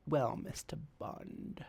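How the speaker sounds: slow breathy villainess purr; seductive villainess. Retold or imitated. Mocking a spy movie vixen, slow seductive villain purr. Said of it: "why is that the thing".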